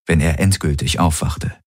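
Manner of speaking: plain reading voice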